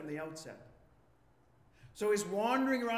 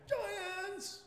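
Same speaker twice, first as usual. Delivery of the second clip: high, put-on cry of alarm; hammed-up frightened spy voice; mock terror; 13 semitones higher than usual. Spies warning the people of giants, mock terror.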